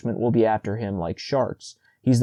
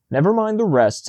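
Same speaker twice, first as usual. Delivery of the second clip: impatient cut-off; 8 semitones higher, 5 dB louder than usual. A narrator's reading. Peter cuts off the seraph.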